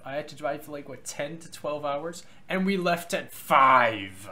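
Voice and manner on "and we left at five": loud, drawn-out complaint; emphatic exclamation. Real, not acted. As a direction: stretch it out loudly in exasperation. Complaining about the early departure.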